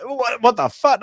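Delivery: high-pitched mock disbelief; acted bewilderment. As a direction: act out startled confusion. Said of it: "and they're like".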